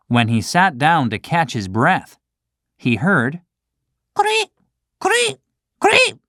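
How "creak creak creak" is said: very high squeaky creak; cricket creaking. Acted. Cricket announcing itself to Pinocchio, squeaky creaking. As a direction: chirp like a tiny insect.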